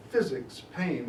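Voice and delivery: ordinary delivery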